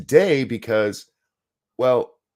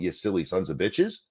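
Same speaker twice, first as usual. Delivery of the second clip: usual speaking voice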